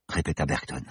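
usual speaking voice

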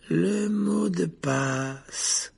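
very slow, high, drawn-out drowsy voice; dozy. A narrator's reading. Drowsy portrait lady asks Harry for the password, dozily. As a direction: drag each word out sleepily. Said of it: "la grosse dame qui somnolait".

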